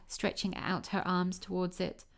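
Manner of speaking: usual speaking voice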